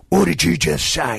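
acted indignant challenge; retold outraged question; mild mock confrontation. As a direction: lightly play someone squaring up. Comedian confronts manager in story.

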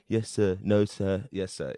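low, soft, unenthusiastic recital; bland line delivery. Retold or imitated. New prisoner answering a shouting guard, blandly. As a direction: give a bland, low-key reading. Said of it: "did a pretty bland performance of it".

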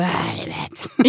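low, slow, voiceless croak; sick, croaky voice. Retold or imitated. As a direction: imitate being too ill to talk properly. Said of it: "Gud vad du låter".

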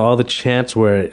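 usual speaking voice

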